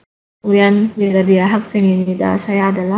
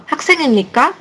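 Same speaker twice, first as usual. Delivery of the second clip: "학생입니까" quick, pitched up as a question; "학생입니까" as a brisk, higher-pitched question; question tune; 7 semitones higher, much faster than usual. Shown as a demonstration. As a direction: ask "학생입니까" with a questioning lift. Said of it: "Itu berarti ditanya".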